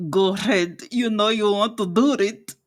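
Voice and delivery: talking through clenched teeth, rolled r; through gritted teeth. Shown as a demonstration. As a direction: say it through your teeth, rolling tongue. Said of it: "talks through her teeth while rolling her tongue".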